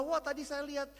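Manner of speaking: lightly voiced delighted child; excited child. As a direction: gush like a thrilled schoolkid. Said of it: "Mereka tertawa".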